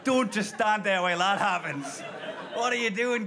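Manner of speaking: ordinary talk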